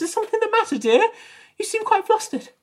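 very high, put-on nurse voice; solicitous nurse. Nurse checking on a player she handed plates to, high and solicitous. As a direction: fuss over them in a high-pitched voice.

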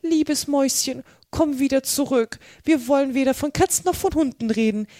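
high, sweet coaxing call; tender call. A narrator's reading. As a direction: call lovingly after a little mouse. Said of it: "Sie rief ihr daher zärtlich nach".

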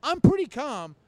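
loud, high, worked-up protest; retold getting mad. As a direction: insist you're calm while clearly losing it. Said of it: "I was getting mad".

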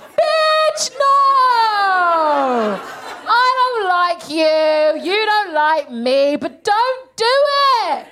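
high, drawn-out wail of "no"; hammed-up desperate objection; anguished protest. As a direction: plead slowly and high, stretching every word. Acted pleading outburst at Theresa May.

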